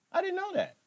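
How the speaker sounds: high, mock-amazed reply to a comment; mock surprise. Streamer feigning amazement at a chat comment, high and mocking. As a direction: pretend to be shocked by the news.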